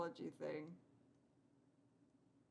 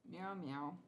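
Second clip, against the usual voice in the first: playful meowing at cat's usual pitch; cat meows. Pretend. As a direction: mew like the kitten. Imitating the cat.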